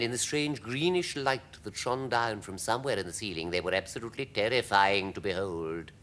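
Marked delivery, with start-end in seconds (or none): none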